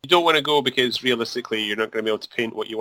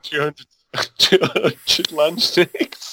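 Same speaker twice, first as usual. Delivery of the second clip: laughing; 5 semitones higher, much slower than usual. Real, not acted. Joking about painting backlog.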